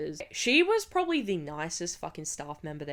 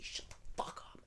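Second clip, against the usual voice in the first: hissed aside to her brother; whisper; 18 dB quieter than usual. Retold or imitated. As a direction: whisper it urgently. Telling brother to be quiet.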